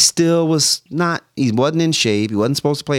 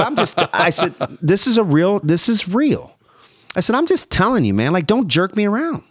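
agitated retort; 6 semitones higher than usual. Retold or imitated. Retold heated phone call with coach.

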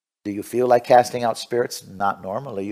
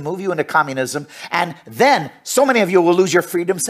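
ordinary talk

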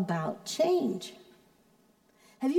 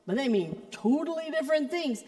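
ordinary delivery

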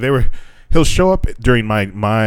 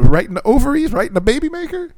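mock threat. Joking threat to lost-voice friend.

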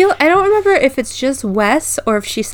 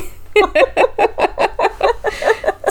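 high giggle; 11 semitones higher than usual. Real, not acted. Laughing at own cheesy pun.

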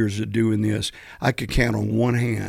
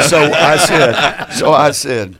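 laughing; 3 semitones higher, 8 dB louder, slower than usual. Real, not acted.

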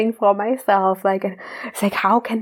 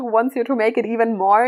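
usual speaking voice

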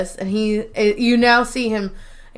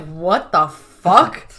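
slow, disbelieving drawl; incredulous; 4 semitones lower, much slower than usual. Retold or imitated. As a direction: stretch it out in disbelief. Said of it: "And Pam's like".

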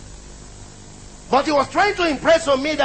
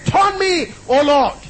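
called-out prayer; 4 semitones higher, 4 dB louder, slower than usual. Pretend. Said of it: "Just go to God and say".

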